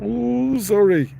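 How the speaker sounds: put-on high, loud, drawn-out child's sorry; grudging mock apology. Bully boy told to apologize, high mock sorry. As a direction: imitate a bully boy's token apology, pitched high. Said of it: "he sort of went".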